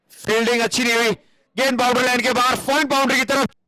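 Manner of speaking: fast, high boundary call; excited cricket commentary. Acted. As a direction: rush the call to the rope.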